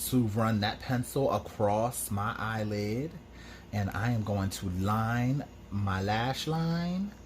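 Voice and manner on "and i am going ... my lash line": ordinary talk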